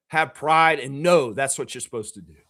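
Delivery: usual speaking voice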